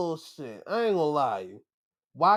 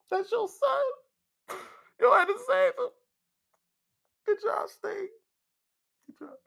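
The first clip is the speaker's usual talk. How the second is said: proud praise. Real, not acted. Fan praising [name] for saving his son, high excited. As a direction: cheer him on like a proud fan.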